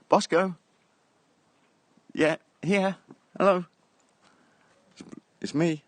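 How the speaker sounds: loud, high coaxing to a deaf, blind dog; calling the dog. Real, not acted.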